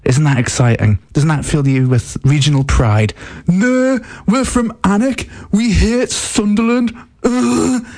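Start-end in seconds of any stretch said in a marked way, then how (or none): 3.5-7.9 high, sneering local listener, much higher pitched; mock a proud Alnwick listener who hates Sunderland. Host mocks Alnwick listeners with a high sneering voice. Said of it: "That's what you sound like".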